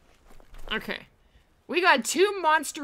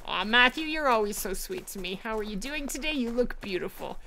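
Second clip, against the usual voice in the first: normal talking voice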